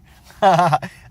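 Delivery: big high laugh; loud burst of laughter. Real, not acted. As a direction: crack up loudly. Laughing at own joke.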